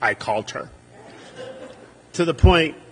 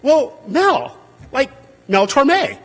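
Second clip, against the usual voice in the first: playful suggestion; 9 semitones higher, 5 dB louder, much slower than usual. Retold or imitated. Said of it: "And I go".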